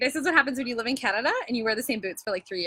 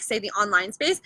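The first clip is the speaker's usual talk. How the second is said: ordinary talk, nothing marked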